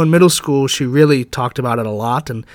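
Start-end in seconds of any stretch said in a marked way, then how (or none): none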